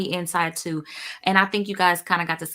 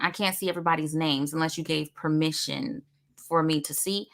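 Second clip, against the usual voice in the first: normal talking voice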